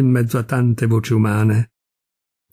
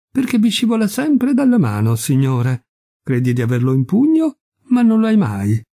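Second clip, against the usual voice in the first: innocent air; 7 semitones higher than usual. A narrator's reading. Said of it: "assunse un'aria candida".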